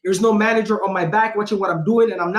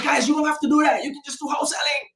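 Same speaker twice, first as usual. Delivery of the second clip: excited shout; 6 semitones higher, 3 dB quieter than usual. Retold or imitated. Telling his older brothers to just do wholesaling, excited high shout. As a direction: blurt it out like the answer is obvious. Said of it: "And I'm like".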